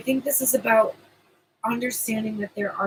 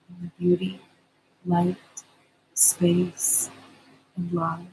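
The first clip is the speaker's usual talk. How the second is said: guided meditation. Acted. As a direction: soothe them into calm, unhurried. Leads the promised meditation.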